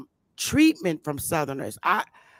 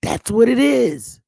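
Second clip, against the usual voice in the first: higher and louder, driving the point home; firm, raised declaration; emphatic; 6 semitones higher, 7 dB louder than usual. Real, not acted. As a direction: land it like a final verdict. Emphatic conclusion to her point.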